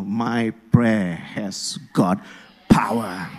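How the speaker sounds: very low, slow and deliberate; weighty declaration. Shown as a demonstration. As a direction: drop deep and space out each word. Models a confident declaration for congregation.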